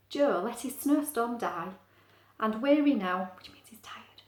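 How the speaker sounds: plain reading voice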